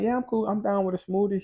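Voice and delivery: put-on girl's voice.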